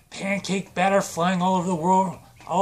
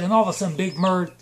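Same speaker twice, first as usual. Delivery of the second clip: ordinary delivery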